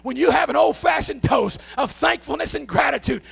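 loud, high, shouting preacher's cry; preaching at full force. Acted. Preacher shouting gratitude to his church, full force. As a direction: pour it out over the crowd, full throttle.

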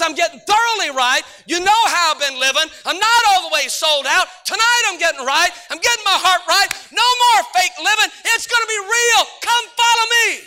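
put-on a kid's fervent vow, building to a cry; rising preacher shout. Preacher voicing a kid to his youth group, building to a shout.

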